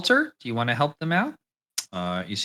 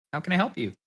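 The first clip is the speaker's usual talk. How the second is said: front desk woman; 6 semitones higher, faster than usual. Acted. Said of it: "She looks up, says".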